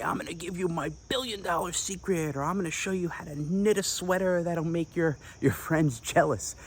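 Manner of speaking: lightly hammed-up cheesy sales line; cheesy pitch. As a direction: ham up a corny marketing promise. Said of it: "you don't wanna be cheesy and say".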